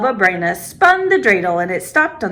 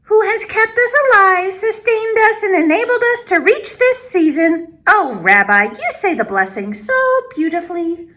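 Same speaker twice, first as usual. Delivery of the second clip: put-on high, bright old grandmother voice; old woman greeting a visitor at her door; Baba Brina greeting; 10 semitones higher than usual. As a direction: welcome the guest like a kind old granny.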